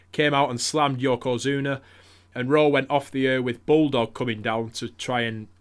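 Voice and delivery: normal talking voice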